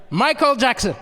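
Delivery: loud shout at normal pitch; mock heckler's yell. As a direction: yell the name out like an insult. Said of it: "He could have yelled out".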